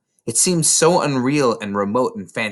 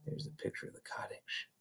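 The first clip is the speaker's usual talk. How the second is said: whispering; 5 semitones higher, 23 dB quieter than usual. Real, not acted. Aside to listener, unvoiced.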